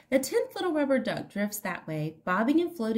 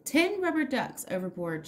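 plain reading voice

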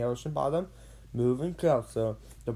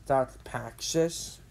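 plain reading voice

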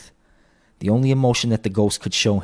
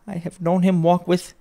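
higher mother's voice, breaking off unfinished; faltering cheer; 10 semitones higher than usual. A narrator's reading. Mother begins a fond memory, faltering. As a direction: try for cheer, then falter and stop. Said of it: "that only faltered once".

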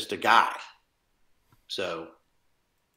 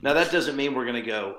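normal talking voice

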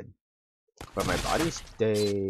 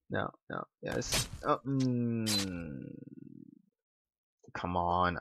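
ordinary delivery

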